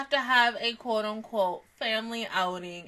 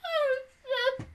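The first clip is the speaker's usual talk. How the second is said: ordinary talk, nothing marked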